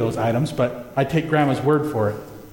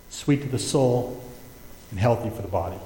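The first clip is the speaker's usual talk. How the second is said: soft scripture reading; 4 semitones lower, 5 dB quieter than usual. Acted. Reading proverb about honey.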